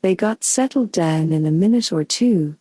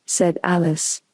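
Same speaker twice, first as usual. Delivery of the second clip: usual speaking voice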